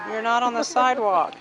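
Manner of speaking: quick, loud reminder; calling out. Real, not acted. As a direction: call out firmly. Scolding child on street.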